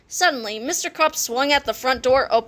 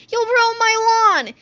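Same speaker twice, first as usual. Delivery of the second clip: high, slow, loud complaint; grumpy principal; 10 semitones higher, 4 dB louder, slower than usual. A narrator's reading. Mister Krupp fretting over his lawn, high slow grumble. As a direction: whine about the lawn.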